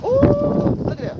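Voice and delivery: high, surprised ooh at the trash. Real, not acted. On a windy livestream, squealing in surprise at flying trash. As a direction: squeal at what the wind blows.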